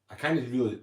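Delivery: ordinary delivery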